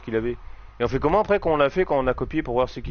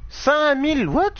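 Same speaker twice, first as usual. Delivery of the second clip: astonished; 11 semitones higher, slower than usual. Real, not acted. Shocked by point total.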